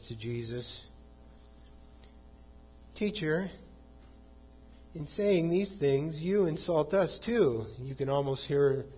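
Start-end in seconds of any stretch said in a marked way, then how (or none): 2.9-7.7 higher-pitched mock complaint; mildly whining. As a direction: lightly imitate an aggrieved lawyer whining. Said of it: "kind of a whining".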